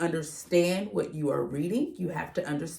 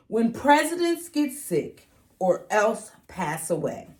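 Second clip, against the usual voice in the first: bright, louder storybook read-aloud; reading to children; 4 semitones higher, 7 dB louder than usual. Acted. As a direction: read it out to a class of kids with energy. Reads picture book aloud to kids.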